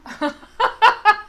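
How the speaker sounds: loud high laugh bursting out; loud burst of laughter. Real, not acted. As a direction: crack up loudly at your own joke. Laughs at her own pun. (éclat de rire fort et aigu)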